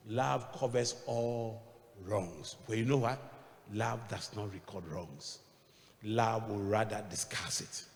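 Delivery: slow, soft scripture reading; gentle preaching read; slow sermon reading. Acted. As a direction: linger over each word of scripture.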